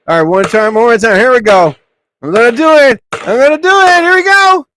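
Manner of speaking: high, loud and rising; hyped up. Real, not acted. Gamer psyching himself up to shoot again, high and loud. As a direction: pump yourself up for the shot.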